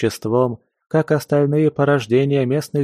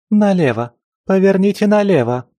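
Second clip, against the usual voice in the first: urgent shout; 9 semitones higher, 5 dB louder than usual. Acted. Girl shouting directions while carried.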